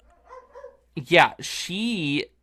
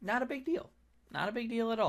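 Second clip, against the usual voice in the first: mock echo; 8 semitones higher, 11 dB quieter, faster than usual. Mocking echo of friend.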